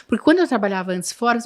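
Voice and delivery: ordinary talk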